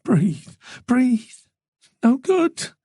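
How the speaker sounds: high breathless self-urging; trying to breathe through tears; sobbing gasps. Acted. Tess weeping in the bar, gasping through sobs.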